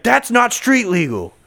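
high, slow, loud cop voice; mock cop. Cop lecturing him about the bike. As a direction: lay down the law, slow and loud.

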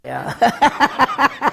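high, loud, exaggerated yeah; mock squeaky yeah. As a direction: ham up a startled yes. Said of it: "I went".

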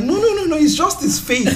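high, laughing explanation; amused, high-pitched reassurance. Retold or imitated. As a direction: laugh while explaining it away. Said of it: "Then I'm like".

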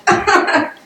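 short high happy laugh; delighted laugh. Real, not acted. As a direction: laugh with relief and joy. Laughing at the team's comeback.

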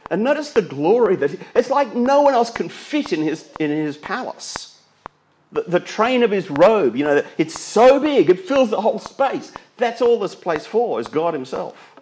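high, loud, full of awe at the glory; awed preaching. Acted. Preacher to congregation, marvelling loudly at the glory. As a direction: lift the voice in wonder. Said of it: "preaching climax on glory".

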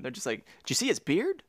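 ordinary delivery

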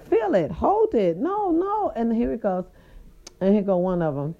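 normal talking voice

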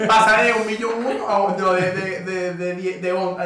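loud, stumbling through laughter; laughing hard. Real, not acted. Friend jokes about Maracay's tiny population, laughing loudly. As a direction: fight real laughter to finish the joke.

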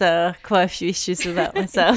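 words broken by a real laugh; laughing while talking. Real, not acted. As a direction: laugh through the admission. Laughing about her own struggles.

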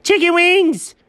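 high, squealed shout of delight; excited cry. Acted. Character crying out for chicken wings, excited. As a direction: call out for food in a high excited voice.

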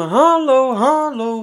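high, loud mock drunk calling out; imitating a drunk man calling. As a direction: ham up a drunk guy at the checkout shouting hello. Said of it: "riep hij de hele tijd". (hoog en luid een bezopen man nadoen die hallo roept)

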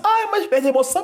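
high-pitched mock complaint; mocking imitation. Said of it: "Aí todo mundo fala assim".

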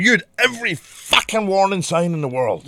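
loud, high, indignant scolding; aggressive shouting. Retold or imitated. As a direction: imitate a nurse shouting a telling-off. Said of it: "she fucking shouted at me".

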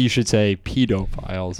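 "pedophiles" said PEE-doe-files, low; long-e correction. Acted. Correcting someone to the polite term, low pedantic voice. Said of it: "they correct you to say".

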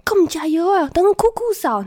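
high mock nagging elders; nagging grandparents. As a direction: imitate grandparents fussing. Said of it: "狂念说".

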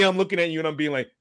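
normal talking voice

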